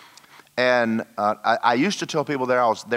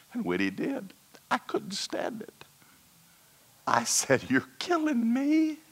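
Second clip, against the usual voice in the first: voice breaking with emotion. Retold or imitated. Overwhelmed by God weeping.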